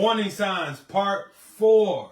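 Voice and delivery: loud hype call of the title; loud announcing. Real, not acted. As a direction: announce it big to the viewers. Hyping the show title.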